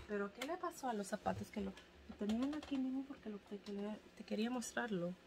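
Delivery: fast, soft Spanish spoken in one of her own Spanish accents. Real, not acted. Searching for the missing shoes, rattling off Spanish in her own Spanish accent, fast and soft. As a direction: mutter it quickly in her Spanish-speaking accent. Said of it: "I have this one".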